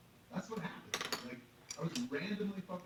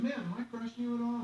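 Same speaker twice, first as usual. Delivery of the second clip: high, loud pleading question; urgent appeal; 11 semitones higher, 8 dB louder than usual. Retold or imitated. Passenger asking woman to his left, urgent. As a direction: call on a witness to back you up. Acted appeal to other seatmate.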